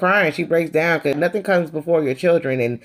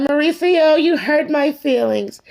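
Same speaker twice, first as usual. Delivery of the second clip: much higher, pouty and slow; whiny hurt Erica; 12 semitones higher, slower than usual. Retold or imitated. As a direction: play the wounded diva. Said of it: "And she was like".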